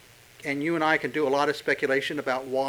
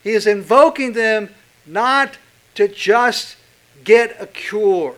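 rising preaching fervor; 5 semitones higher, 4 dB louder than usual. Real, not acted. Sermon climax building up.

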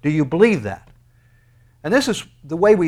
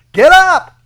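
shouted command; 15 dB louder than usual. Acted. Preacher shouting God's command.

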